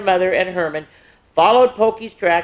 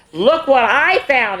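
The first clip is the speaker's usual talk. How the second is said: higher, slower, proud of the find; excited exclaim; 8 semitones higher, 3 dB louder than usual. A narrator's reading. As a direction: show off the treasure. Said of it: "exclaimed Pokey".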